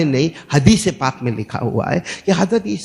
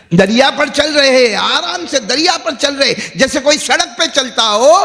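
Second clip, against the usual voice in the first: high, raised storytelling from the pulpit; fervent preaching; 8 semitones higher than usual. Acted. Preacher telling congregation of walking on water, fervent. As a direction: proclaim the miracle with rising fervour.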